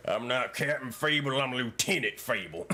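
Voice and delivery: a hint of a cowboy character; lightly voiced cowboy officer; Lieutenant Fable voice. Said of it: "Do it in character as Captain Fable".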